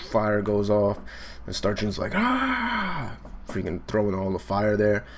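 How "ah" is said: long, high "aah" held on one note; hammed-up sustained yell; mock battle scream. Acting Star June's attack yell.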